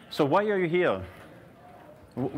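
ordinary delivery

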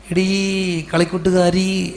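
slow, drawn-out call; sentimental mock address. Old schoolmate addressed in an autograph book, mock-sentimental drawl. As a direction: stretch each word like a sentimental salutation.

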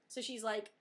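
ordinary talk, nothing marked

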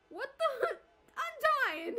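high, loud burst of laughter; laughing hysterically. Real, not acted. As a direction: crack up mid-line. Cracking up at game scene.

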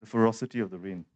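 ordinary delivery

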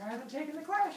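high, protesting put-on voice; defensive retort. Defensive reply in mock quarrel.

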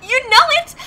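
high, bright giggle into the answer; giggly laugh. Acted. Answering her bestie, giggling high. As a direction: laugh it off happily.

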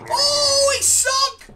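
high, loud burst of glee; excited yelp. Real, not acted. Gamer yelps to viewers as the character sinks, excited shout.

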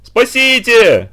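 high, loud, very slow drawn-out wail; wailing for help. Acted. Lyoshka wailing again for rescue in the dark, drawn-out wail. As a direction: howl the word long and desperate. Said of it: "опять завопил Лёшка".

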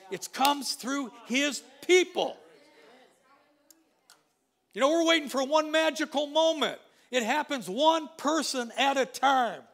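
fiery revival preaching; preaching at full force. Real, not acted. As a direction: drive it home to the congregation.

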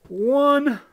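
loud, slow taunting; mocking taunt. A bird loudly mocking a single pig. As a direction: jeer at the little pig.

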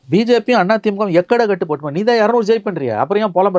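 high, loud taunt at rivals; mocking taunt. Real, not acted. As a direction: needle them, sneering. Taunting DMK rivals directly.

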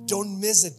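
usual speaking voice

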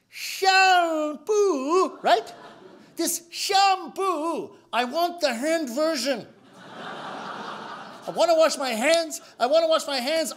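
high, slow, spelled-out miming; slow explaining. Retold or imitated. Customer explaining hand soap to clerk, slow high.

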